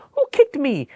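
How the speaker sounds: high, loud, mischievous little voice; mischievous bunny. Acted. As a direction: tease like a naughty bunny. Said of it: "says one with a mischievous smile".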